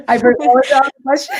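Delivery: laughing sheepishly through the words, quick. Real, not acted. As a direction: giggle it out, a bit embarrassed. Guest laughs, lost her question.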